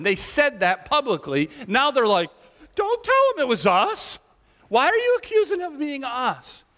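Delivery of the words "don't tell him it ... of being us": very high, whiny protest; whiny high priest. Retold or imitated. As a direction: whine like a caught child. Priests whine, voice cracking high.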